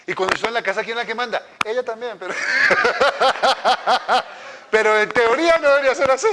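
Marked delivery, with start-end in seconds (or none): 2.4-4.6 long real burst of laughter; hearty laugh. Real, not acted. Preacher joking that his wife rules the house, hearty laugh. Se ríe de su propio chiste. 4.7-6.3 high, loud words through laughter; laughing. Real, not acted. Preacher joking about who rules at home, laughing. Sigue riendo tras el chiste.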